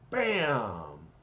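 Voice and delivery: long, falling bam; imitated blast. Narrator sounds out the bolt hitting the tank, imitated blast. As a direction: draw the impact out like an explosion.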